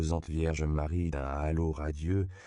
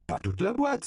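alarmed cry, very high and louder; 4 dB louder than usual. A narrator's reading. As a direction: shout to stop the throw. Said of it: "s'écria Seigneur Eduardo".

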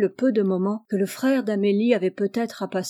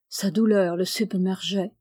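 ordinary delivery, nothing marked

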